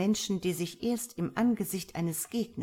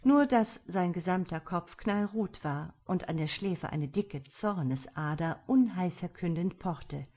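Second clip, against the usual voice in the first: ordinary delivery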